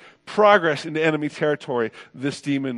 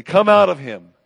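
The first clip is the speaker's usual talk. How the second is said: Jesus; 2 semitones higher, 7 dB louder than usual. Acted. Jesus commanding a demon to leave, sternly. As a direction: order it out sternly. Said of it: "Jesus says sternly".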